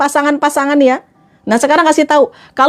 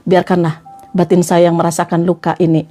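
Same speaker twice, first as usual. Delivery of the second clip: low, melodramatic wounded voice; mock martyr nursing a hurt; silent suffering; 8 semitones lower than usual. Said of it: "Jangan kayak gini". (pura-pura terluka, suara rendah)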